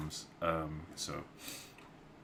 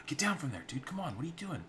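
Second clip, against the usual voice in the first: scolding sister; 9 semitones higher, faster than usual. Acted.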